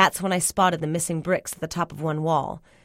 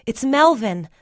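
urgent, higher and louder; gasped; 5 semitones higher, 6 dB louder, slower than usual. A narrator's reading. Telling Cyril who is in the cellar, gasped. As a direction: blurt it out with alarm. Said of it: "I gasped".

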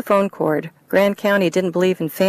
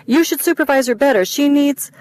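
lecturing; 8 semitones higher, 3 dB louder than usual. Acted. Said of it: "Louise paused to build up steam".

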